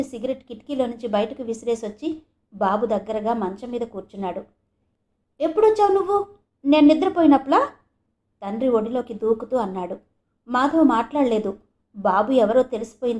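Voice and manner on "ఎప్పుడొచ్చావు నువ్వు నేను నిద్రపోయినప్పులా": put-on a child voice, higher and louder; an eager little boy asking; excited child. A narrator's reading. Boy jumps into his father's lap, high excited child voice.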